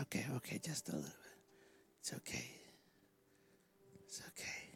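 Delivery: very soft whispered thanks; whispered prayer. Real, not acted. Preacher whispering thanks and comfort to the woman. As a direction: breathe the prayer almost silent.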